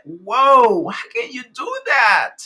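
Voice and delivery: high, loud amazed outburst. Retold or imitated. Host retelling her shock at the actress's accent, loud amazed cry. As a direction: blurt it out, stunned by a hidden accent. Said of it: "I'm like".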